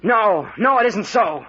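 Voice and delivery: loud, high, pleading denial; desperate denial. Acted. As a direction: cry out against the accusation. Accused of murder, denies.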